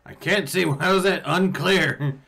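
high, loud and fed up; exasperated shout. Acted. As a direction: yell it again, annoyed. Exasperated shout repeating himself.

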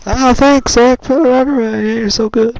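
sarcastic shout. Real, not acted. Sarcastic thanks after failed ride.

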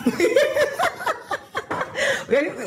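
high squealing laugh at herself; big laugh. Real, not acted. Cook laughing at her own pronunciation, high laugh.

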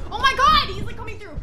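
high, loud cry of shock; alarmed shriek. Real, not acted. Player sees rat coming through, high-pitched alarm. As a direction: yelp in sudden panic, then ease slightly.

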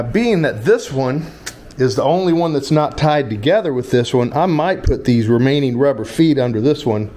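ordinary delivery

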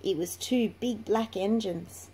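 plain reading voice

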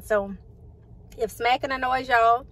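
ordinary delivery

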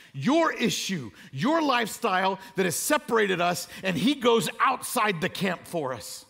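loud, high, ringing preacher's build; impassioned sermon climax; full-force preaching. Acted. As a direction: preach it out to the whole room, rising.

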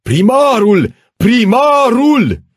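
very high, full-throated yell; howling mob. Acted. Crowd outside the town hall demanding the mayor, howling. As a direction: roar it like a mob, drawing out the repeat. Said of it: "unde urla".

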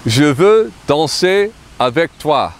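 je veux danser avec toi, stress on veux; French pronunciation. Shown as a demonstration. Teacher models the whole sentence, careful French. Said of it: "a little French sentence magic".

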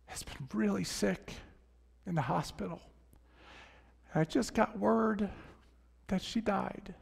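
hushed, choked telling of a wife's death; grieving father. Retold or imitated. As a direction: let the grief thin the voice. Grieving widower's words retold.

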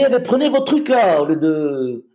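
retold sneer from a woman at the food bank; scornful lady. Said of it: "Elle me dit".

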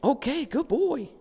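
very high, drawn-out cooing praise; excited puppy praise. Real, not acted. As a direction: squeal it happily to a puppy, slowly. Praises puppy for touching bells.

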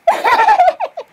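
very high-pitched squealing giggle; giddy laugh. Acted. Character overwhelmed after gushing, giddy giggle. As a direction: let out a long, high giggle.

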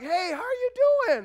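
loud, high, eager hey; hammed-up cheery greeting from an old friend; old friend's greeting. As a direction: call out like an old buddy spotting you. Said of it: "And they're like".

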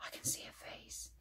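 awed unvoiced whisper; gasped whisper. Alfie spotting the face in the bark, awed whisper. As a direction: breathe it out in wonder. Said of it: "Alfie gasped".

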